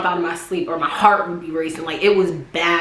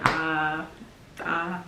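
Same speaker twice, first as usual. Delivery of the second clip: ordinary delivery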